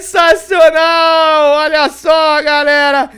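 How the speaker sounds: loud, slow triumphant shout; explosive goal commentary. Real, not acted. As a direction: drag out the word in joy. Celebrating the goal. (comemoração gritada)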